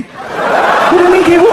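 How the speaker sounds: put-on high, drawn-out child voice; alarmed child. Son questions stepdad about police, alarmed child voice. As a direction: play a worried little boy.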